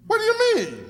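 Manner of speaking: high, loud shocked cry; stunned. Acted. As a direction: blurt it out in disbelief. Lawyer stunned: not her husband.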